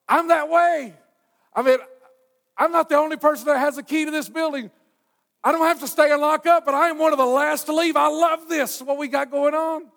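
high, loud, exuberant sermon voice; full-force preaching. Real, not acted. Preacher telling the church about lingering in fellowship, full force. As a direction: preach it at the top of your range.

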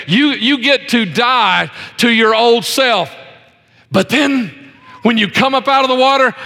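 high, driving sermon delivery; impassioned pulpit voice; fervent preaching. Real, not acted. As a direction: preach it with rising pitch and conviction. Preaches on baptism.

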